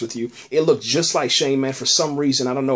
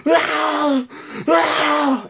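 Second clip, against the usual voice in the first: mock anguished shout, much higher; anguished scream; 12 semitones higher, much slower than usual. Daryl chasing the car with Beth, anguished mock scream. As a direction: scream her name as she's driven off. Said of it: "He's like".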